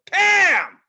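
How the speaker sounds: high, loud shout of "Pam!"; shouted name. Shown as a demonstration. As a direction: yell it back at whoever yelled. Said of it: "I'm just gonna go".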